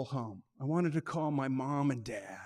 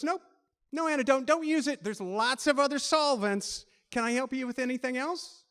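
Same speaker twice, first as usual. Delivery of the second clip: higher, matter-of-fact brush-off; brisk operator; 7 semitones higher than usual. Retold or imitated. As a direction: rattle it off and move on. Operator briskly closing call.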